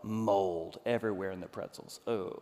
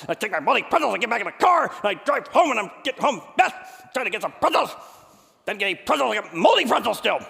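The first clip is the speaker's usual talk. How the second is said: much higher and louder, ranting in a rush of anger; escalating rant; 10 semitones higher, 9 dB louder than usual. Retold or imitated. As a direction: let the frustration boil over, loud and high. Ranting about the pretzel saga.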